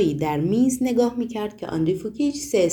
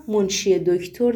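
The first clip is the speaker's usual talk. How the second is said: ordinary delivery, nothing marked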